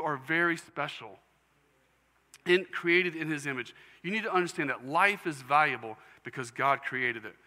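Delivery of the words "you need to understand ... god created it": ordinary talk, nothing marked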